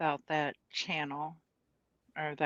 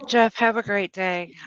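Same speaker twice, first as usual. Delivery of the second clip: cheerful sign-off; 5 semitones higher, 12 dB louder than usual. Real, not acted. Calling out goodbye to chat.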